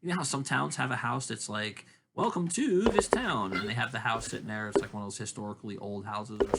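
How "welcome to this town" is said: slow, high, sing-song welcome; drawn-out tourist greeting, pitched up; welcoming sign voice. Retold or imitated. Town sign welcoming visitors, slow high sing-song.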